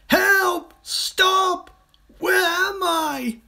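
high, loud dazed yelps, slowing at the end; tumbling and bewildered; panicked cries. A narrator's reading. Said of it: "cried the crocodile".

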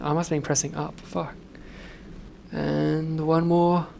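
ordinary talk

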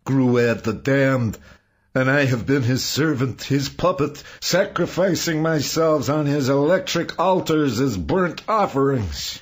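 loud, high-pitched anguished outburst; screaming while weeping. A narrator's reading. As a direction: scream it out like a tormented confession. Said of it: "Saul screamed, weeping, beating his fist".

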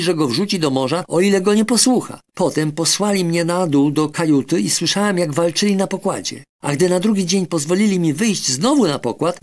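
put-on high, frightened little boy's voice. Boy telling the doctor how pirates took his uncle, frightened and high.

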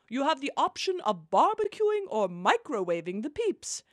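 normal talking voice

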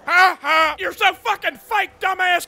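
barking drill sergeant berating a recruit; angry drill sergeant. Acted. Drill sergeant chewing out a player in the lobby. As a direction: play the foul-mouthed sergeant.

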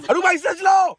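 hammed-up high panicked shout. Husband yells at wife driving his new car, panicked mock shout. Passenger begs driver to slow.

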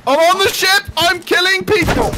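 high-pitched excited yelling; frantic excitement. Real, not acted. Player reporting a rampage to the crew, high frantic excitement. Boarding the enemy in game.

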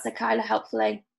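plain reading voice

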